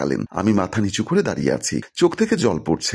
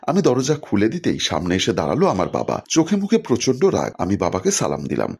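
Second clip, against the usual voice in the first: plain reading voice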